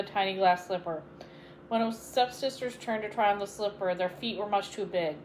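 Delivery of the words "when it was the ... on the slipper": plain reading voice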